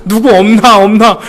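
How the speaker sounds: loud, high mock furtive "누구 없나, 없나?"; guilty look-around. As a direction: ham up checking nobody saw. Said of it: "범죄한 것처럼 주변을 막 이렇게 돌아보면서".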